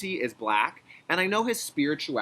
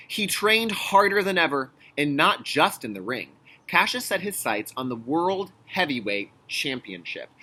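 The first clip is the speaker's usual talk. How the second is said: louder storybook reading; reading to children; 5 dB louder than usual. Acted. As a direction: read it with drive for kids. Reads the picture book aloud.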